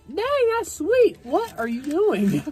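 mock food reviewer. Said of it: "and it's like".

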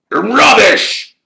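high, explosive, loud snarl. A narrator's reading. Caretaker dismissing Harry's denial, snarling. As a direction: snarl it out as one furious burst. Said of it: "snarled Filch".

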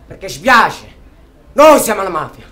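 loud, sarcastic declaration; a furious shout into the microphone; bitter shout. Acted. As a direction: hurl it at the listeners like an accusation. Bitter radio tirade against mafia.

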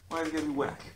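ordinary delivery

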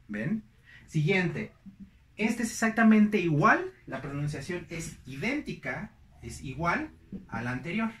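ordinary talk

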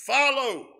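"Follow." barked high and loud; drill sergeant command. Shown as a demonstration. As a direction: order the men to follow. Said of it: "We could say it like a drill sergeant".

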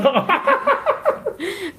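high-pitched burst of laughter; real laugh. Real, not acted. Host laughs at own joke.

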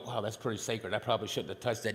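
soft, awed murmur to herself; half-whispered aside, like seeing something holy; hushed inner thought. Retold or imitated. New female brain musing over a bowl, hushed. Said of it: "I look at that bowl and go".

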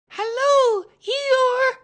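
put-on very high, loud childlike squeak; excited squeak. A narrator's reading. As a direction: squeal the greeting out at full volume. Excited Roo squeaks greetings again.

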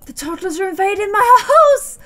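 sudden alarmed outcry; startled gasp and high cry; shocked gasp. Real, not acted. Toddlers suddenly enter house.

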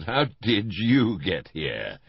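slow, loud startled question; shouting. Acted. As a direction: call out in amazement. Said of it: "I shouted".

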